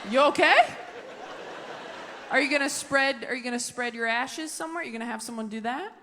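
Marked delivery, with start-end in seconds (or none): none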